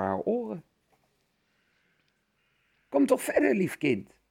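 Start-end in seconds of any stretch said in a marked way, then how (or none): 2.9-4.2 veel hoger, gespeeld verkouden oma; wolf die verkouden oma speelt; verkouden omastem. A narrator's reading. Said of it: "Haar stem klonk echt heel verkouden". (wolf lokt Roodkapje binnen met gespeelde verkouden stem)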